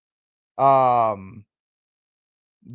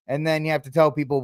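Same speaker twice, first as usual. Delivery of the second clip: ordinary talk, nothing marked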